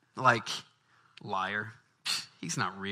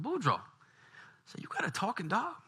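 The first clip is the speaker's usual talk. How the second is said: lightly voiced Cajun neighbour, higher; a hint of a curious Cajun; Thibodeau voice; 7 semitones higher, 9 dB quieter than usual. Thibodeau asks Boudreaux about the dog, lightly voiced.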